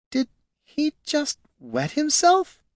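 very high, slow, hesitant princess. A narrator's reading. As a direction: ask it slowly in a high girlish voice. Princess asks in her put-on voice.

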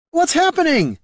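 very high, alarmed shout. A narrator's reading. Niln ringed in by lizard men, shouted. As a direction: yell the question over the fray. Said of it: "Niln shouted".

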